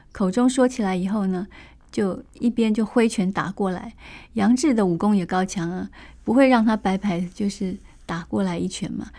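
ordinary delivery

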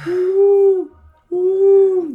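long high hooting whale cry; whale call. Retold or imitated. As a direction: moan out a high whale song. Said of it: "会听到鲸鱼的叫声".

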